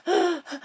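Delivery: a sharp, soft frightened intake of breath; shocked gasp. Retold or imitated. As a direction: gasp like a startled little girl. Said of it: "This little thing's hyperventilating".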